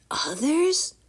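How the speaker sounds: high, questioning; puzzled thought. A narrator's reading. Drake puzzling over the wizard's words. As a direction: wonder to yourself. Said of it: "Drake thought".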